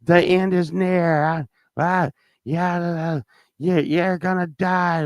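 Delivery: high-pitched doomsday rant, drawn out; street preacher yelling. Retold or imitated. Crazy man yelling at strangers on the street, raving doom. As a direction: shout the end is near like a raving stranger. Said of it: "he's yelling".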